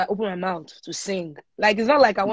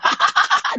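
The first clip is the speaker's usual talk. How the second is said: breathy mocking laugh burst; imitated laugh; 4 dB louder than usual. Friend laughing at her studio request, mock laugh. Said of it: "He was literally laughing".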